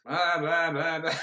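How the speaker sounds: empty chatter, lightly mocking; put-on droning blah-blah; mock yammering. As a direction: imitate someone babbling on. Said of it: "He's yammering".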